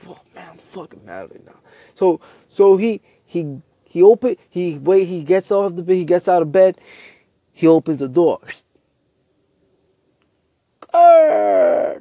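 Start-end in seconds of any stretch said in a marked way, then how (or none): 0.1-1.6 acted mad grumble, low and very soft; angry grumble. Narrator voicing Smart, too angry to sleep, grumbling. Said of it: "He can't sleep 'cause he's angry". 10.8-12.0 low, loud door creak imitation; creaking door noise. Narrator showing the bedroom door opening, low creak. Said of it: "that's the door noise".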